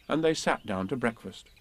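plain reading voice

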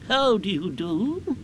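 high, slow owl voice; Owl's greeting. A narrator's reading. Owl greets his friend.

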